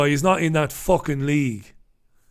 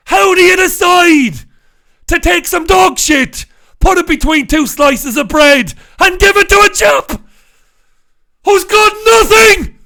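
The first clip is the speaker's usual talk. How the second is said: outraged shout; 11 semitones higher, 8 dB louder than usual. Real, not acted. Outraged rant about the cop.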